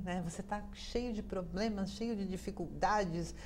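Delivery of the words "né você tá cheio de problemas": normal talking voice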